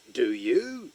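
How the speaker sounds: ordinary delivery, nothing marked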